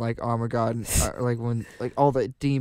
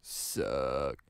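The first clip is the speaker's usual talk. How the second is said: much lower, soft drawn suck; deep mock drop; 11 semitones lower, 9 dB quieter than usual. Hosts echoing the episode theme, deep deadpan. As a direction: let it sink low. Catchphrase dropped low.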